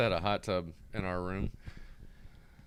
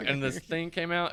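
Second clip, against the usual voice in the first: ordinary delivery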